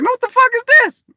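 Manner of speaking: high, fast, loud bewildered outburst; bewildered Tom Cruise. Retold or imitated. Tom Cruise waking up somewhere else, high fast bewildered outburst. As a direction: blurt it high and fast, totally lost. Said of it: "woke up somewhere else and was like".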